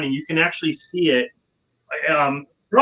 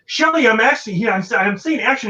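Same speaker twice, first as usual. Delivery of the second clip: mock angry director; 7 semitones higher than usual. The director opening the door on the actress, mock angry. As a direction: scold like a director in a rage. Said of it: "He's like".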